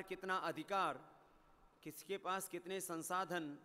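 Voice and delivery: ordinary delivery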